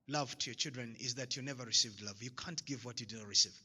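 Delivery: normal talking voice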